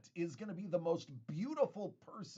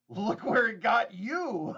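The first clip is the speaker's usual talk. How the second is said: teasing repeat; 6 semitones higher, 8 dB louder, slower than usual. Pretend. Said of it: "Hey, Paul".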